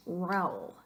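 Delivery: "Raúl" squashed into one syllable, like "rowl". Shown as a demonstration. Teacher shows the obnoxious diphthong, "Raúl" as one syllable. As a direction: run the a and u of "Raúl" together as one glide. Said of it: "It would come out a very obnoxious".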